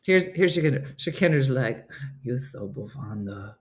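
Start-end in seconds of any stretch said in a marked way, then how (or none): 2.2-3.5 low, soft mock teen voice; imitating a bored cartoon teenager; Lily. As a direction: drop it low and quiet. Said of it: "She's doing in her Lily voice".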